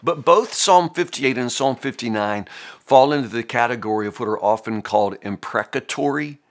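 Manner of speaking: ordinary talk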